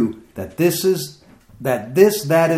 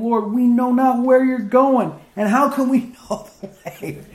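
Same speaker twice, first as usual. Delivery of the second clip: puzzled Thomas; 11 semitones higher than usual. Acted. Preacher voicing Thomas asking Jesus, high and bewildered. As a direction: play a baffled follower, chuckling mid-question.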